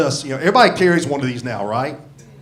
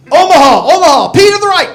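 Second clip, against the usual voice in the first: high, loud barked play call; quarterback audible call; 13 semitones higher, 14 dB louder than usual. Retold or imitated. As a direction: shout it like a quarterback at the line. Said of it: "like Peyton Manning calling an audible".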